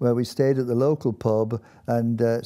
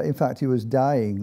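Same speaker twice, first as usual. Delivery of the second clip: ordinary delivery, nothing marked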